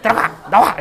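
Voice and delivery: 엄마가 소리 지르듯; mock parent yell. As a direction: shout it like a nagging parent. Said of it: "소리를 지르거나".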